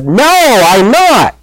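high-pitched, indignant "no"; acted-out protest, pitch jumping up; emphatic cursing denial. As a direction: deny it hotly, pitched high. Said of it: "he curses and says".

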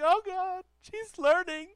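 overwhelmed proud dad near tears; blubbering with happy tears; teary choked-up joy. Retold or imitated. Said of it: "Tears start welling up my eyes".

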